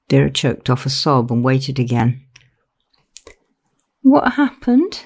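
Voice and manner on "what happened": put-on high, slow child's question. A narrator's reading. Daughter asking how her father died, child's voice. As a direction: ask it like a lost little girl. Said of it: "It was the child's voice Vera heard".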